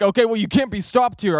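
loud, high imitation of the man; stern stranger warning. Acted man warning them off.

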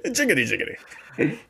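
put-on high, playful old-time jingle voice; old-timey voice. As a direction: chirp it like an old-timer. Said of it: "speaking of old-timey voices and names".

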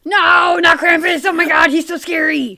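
high, loud panicked wail; playfully terrified shriek; mock terror. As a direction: cry out in hammed-up fright.

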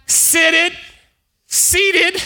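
high ringing repetition; high emphatic preach. Acted. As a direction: lift it up high.

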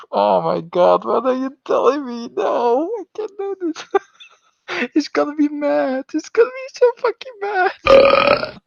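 high, loud, half-laughing panic; panicked excitement. Real, not acted. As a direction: squeal in mock horror at the dare. Caught out by donation dare.

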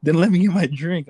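half-laughing mock outrage; mock pissed-off. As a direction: imitate a pissed friend, laugh breaking in. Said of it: "And he was pissed".

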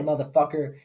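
ordinary delivery

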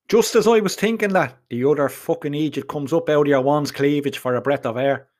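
plain reading voice

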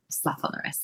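breathy whispered aside, self-scolding. Real, not acted. Guest scolding herself in a whispered aside. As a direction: drop to a whisper and tell yourself off.

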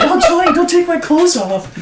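hammed-up damsel voice; mock girly squeal. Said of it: "And it's like".

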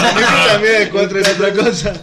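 loud words broken by laughter; laughing. Real, not acted. Friends cracking up at the joke, laughing loudly. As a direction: laugh through the whole line.

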